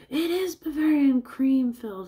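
high, loud cartoonish delight; excited imitation. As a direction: exclaim it in a high put-on voice, overjoyed.

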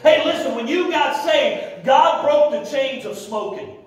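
high, emphatic pulpit exhortation; fiery preaching. Real, not acted. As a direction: drive each phrase home to the congregation. Preaching at full force.

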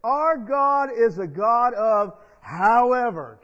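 slow, loud proclamation; preacher's declaration; preaching emphasis. Real, not acted. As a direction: drag it out and preach it. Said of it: "Let me say".

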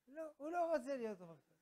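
loud, high refusal; hammed-up outburst of refusal; acted protest.

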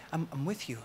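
soft, hushed reassurance; tender and quiet; gentle reassurance. Retold or imitated. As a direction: voice God comforting someone, very softly. Voicing God's reassurance to the fearful.

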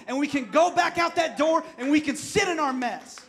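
loud, rising preacher's call to respond; preaching at full force. Acted. Pastor urging his congregation, preaching hard. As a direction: drive it home like a revival preacher.

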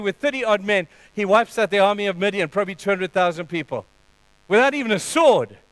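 usual speaking voice

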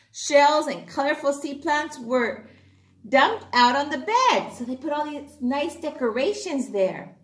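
usual speaking voice

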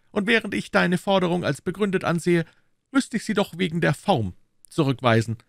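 very high, formal musketeer voice; Aramis. A narrator's reading. Musketeer rejects his friend's demand, high and formal.

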